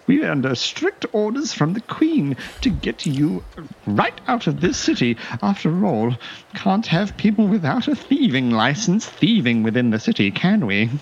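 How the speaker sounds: high smug dandy reciting the rules; smug fop. Acted. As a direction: lecture the thief with mock courtesy. Fop guard lectures the thief.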